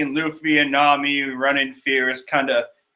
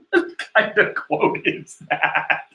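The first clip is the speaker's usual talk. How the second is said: normal talking voice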